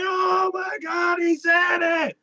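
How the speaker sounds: high, slow, stunned cry; high awestruck mock shout; awed crowd. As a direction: gasp it out in amazement.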